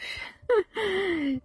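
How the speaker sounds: high, airy giggle; short high laugh, trailing down; happy laugh. Acted. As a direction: giggle with relief at making it. Reading Lily's joy at reaching lake.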